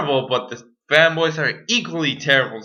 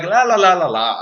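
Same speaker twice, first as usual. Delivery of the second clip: mocking singsong; 6 semitones higher, 5 dB louder than usual. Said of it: "They're like".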